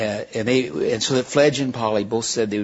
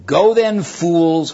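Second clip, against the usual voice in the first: higher and louder, scornful; cried out; 6 semitones higher, 7 dB louder, much slower than usual. A narrator's reading. Witch cries after the fleeing children, loud and scornful. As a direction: fling the words after them. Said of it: "cried the witch".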